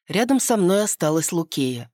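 ordinary delivery, nothing marked

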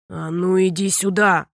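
low, loud angry order; a father calling a runaway child. A narrator's reading. Angry father chases daughter. (низким сердитым окриком)

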